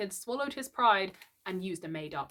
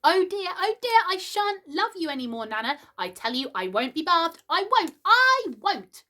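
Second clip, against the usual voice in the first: put-on high, loud boy shouting his refusal; child's tantrum; 11 semitones higher, 6 dB louder than usual. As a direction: stamp your foot like a small boy who won't be bathed. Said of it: "he had shouted".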